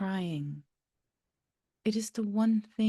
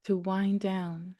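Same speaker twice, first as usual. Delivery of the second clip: guided meditation; 3 semitones lower, 5 dB quieter than usual. Acted. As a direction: gently lead listeners into calm, phrase by phrase.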